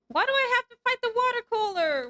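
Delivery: high, loud cry of disbelief; loud incredulous whine at the game; baffled protest. Real, not acted.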